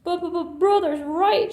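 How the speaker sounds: blurting through sobs; voiced crying cub; sobbing. A narrator's reading. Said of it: "she sobbed".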